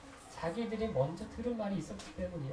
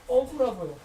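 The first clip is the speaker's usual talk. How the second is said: higher-pitched acted complaint; pouring out grievance; 5 semitones higher than usual. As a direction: vent frustration as if to God, in short bursts. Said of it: "토해내세요". (억울함을 토해내듯 하소연)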